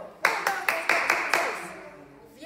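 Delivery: loud, rapid counting shout; fast shouted count. Shown as a demonstration. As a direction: bark the numbers to speed them up. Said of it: "Más rápido".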